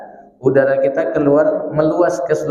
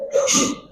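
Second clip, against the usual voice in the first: whispered shh to shoo chickens; unvoiced shooing shh; shooing hiss; 4 dB quieter than usual. Shown as a demonstration. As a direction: hiss shh like chasing hens away. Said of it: "ngusir ayam".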